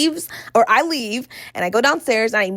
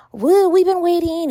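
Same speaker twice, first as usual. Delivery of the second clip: high, loud, drawn-out whine of complaint; mock complaining; 9 semitones higher, 5 dB louder than usual. Date complains to restaurant staff about waiting, mock complaining. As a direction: imitate a date moaning at the staff, loud and slow. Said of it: "starts complaining to them about how".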